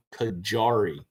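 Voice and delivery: "Kajari" with a hard J, like a soft G. Shown as a demonstration. Gardener explaining a melon's name, "Kajari" with hard J. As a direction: say "Kajari" with the middle sounded as in jar. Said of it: "like a, like a hard J, I guess, or almost like a G".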